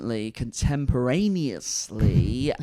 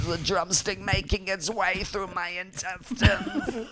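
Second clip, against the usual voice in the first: mock whine; 6 semitones higher, 5 dB quieter than usual. Silly put-on voice bit.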